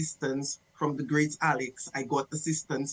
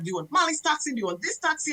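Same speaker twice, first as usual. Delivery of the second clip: higher, pestering questions; mock waitress; 9 semitones higher, faster than usual. Waitress pestering customer about taxis, pitched up. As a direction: keep pestering him, pitched up.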